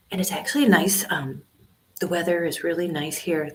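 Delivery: normal talking voice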